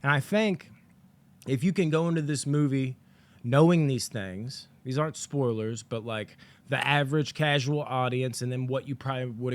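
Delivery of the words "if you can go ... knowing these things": ordinary delivery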